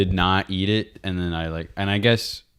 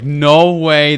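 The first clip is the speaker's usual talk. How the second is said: tag; 4 semitones higher, 7 dB louder than usual. Retold or imitated. Acting out own disbelief.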